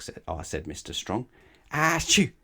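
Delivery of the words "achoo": a high, loud sneeze. Acted. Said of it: "sneezed Little Miss Stubborn".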